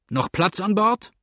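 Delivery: high, slow hailed question; calling out. A narrator's reading. Man ashore asking the boat, slow high call. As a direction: call the question slowly across water.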